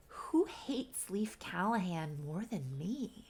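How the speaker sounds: slow, conspiratorial whisper; thinking to himself. Retold or imitated. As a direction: whisper it like a brooding private thought. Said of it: "then he starts to think".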